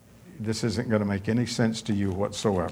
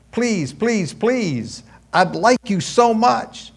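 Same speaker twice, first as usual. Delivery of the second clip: put-on begging boyfriend; 10 semitones higher, 4 dB louder, slower than usual. Boy begging for a kiss.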